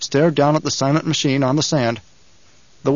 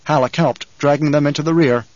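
ordinary delivery, nothing marked

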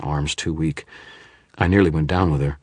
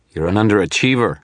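quick, defiant retort; shot back; 5 semitones higher, 5 dB louder than usual. Acted. Wizard taunts the shadow, defiant retort. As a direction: fire the jab straight back. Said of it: "I shot back".

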